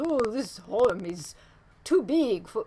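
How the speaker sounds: put-on slow, half-hearted Russian accent on a Soviet official; a weak stab at a Russian accent, drawn out; mock Russian accent. A Doctor Zhivago official eyeing a big home, weak Russian accent. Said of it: "well, that's not really a Russian accent".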